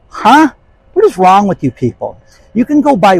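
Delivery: loud, high incredulous outburst; escalating comedy rant. Real, not acted. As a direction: blow up at the audience.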